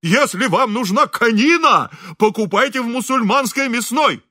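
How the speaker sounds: high, loud, outraged tirade; hysterical shouting. A narrator's reading. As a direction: rail hysterically at the horse's owner. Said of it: "истерически кричал управдом". (истерический возмущённый крик)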